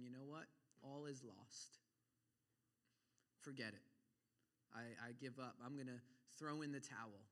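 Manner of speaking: low, soft, giving up bit by bit; depressed resignation. Acted. Preacher voices depressing thoughts throwing in the towel, depressed resignation. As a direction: sink quietly into defeat, with pauses. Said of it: "those depressing thoughts".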